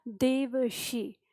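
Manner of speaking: देवशी drawn out very slowly; slow spelled-out demo. Shown as a demonstration. Teacher slowly models the word देवशी for students. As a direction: stretch देवशी syllable by syllable. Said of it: "देवर्षि होता है".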